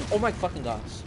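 loud startled outburst; shocked exclaim. Real, not acted. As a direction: blurt it out in shock. Reacting to sudden attack.

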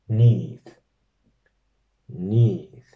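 teacher modelling the second form. Shown as a demonstration. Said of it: "un poquitito más fácil".

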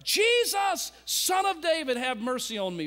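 high, loud shouted plea. Acted. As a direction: shout over the crowd for mercy. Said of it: "He cried the more a great deal, louder".

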